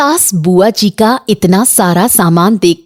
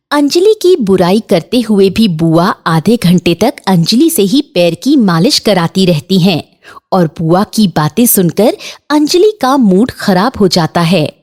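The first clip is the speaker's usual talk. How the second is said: ordinary delivery, nothing marked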